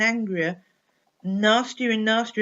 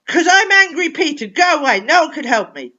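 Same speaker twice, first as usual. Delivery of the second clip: loud, higher furious yell; angry shout; 7 semitones higher, 12 dB louder than usual. Acted. Angry boy shouting at the coach to go away.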